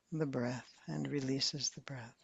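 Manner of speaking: whispered. Acted. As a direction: trail off into a soft whisper. Meditation cue on releasing breath.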